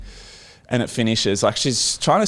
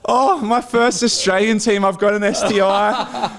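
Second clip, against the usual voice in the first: mock panic; 9 semitones higher than usual. New player fearing for his first team, mock-panicked wail. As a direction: replay your panic, high-pitched and half-laughing.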